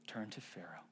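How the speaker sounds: low hushed whisper of turned to Pharaoh. Shown as a demonstration. Whispered repeat of phrase.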